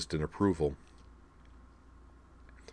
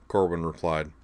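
plain reading voice

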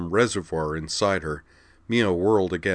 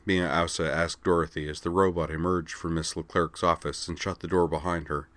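plain reading voice

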